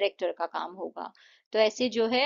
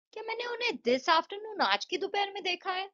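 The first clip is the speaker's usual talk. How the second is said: high-pitched question on "this afternoon, आज की दोपहर में"; questioning intonation; 9 semitones higher, 3 dB quieter than usual. Shown as a demonstration. Teacher voicing the statement as a question, high questioning tune up to "देखा है". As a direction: pitch held high right through to "देखा है", as if unsure. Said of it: "ये question बन गया".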